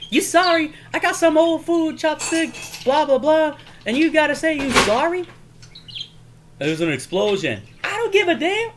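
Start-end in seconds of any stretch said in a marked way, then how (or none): 0.1-5.3 high, loud comic outrage; mock indignation. Acted indignant movie quarrel. 7.8-8.7 very high comic outburst; mock angry retort. Acted angry movie retort.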